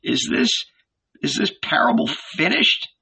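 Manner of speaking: put-on very high, halting old man's voice; stammering. A narrator's reading. Old proprietor asks whether the parable is over, stammering. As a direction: stumble on the first words, fearfully high. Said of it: "stammered the old".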